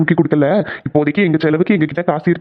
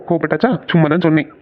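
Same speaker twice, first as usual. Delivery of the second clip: ordinary talk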